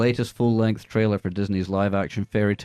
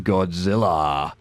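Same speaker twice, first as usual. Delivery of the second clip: dramatic drawl; 3 semitones lower, much slower than usual. Pretend. Drawn-out dramatic name.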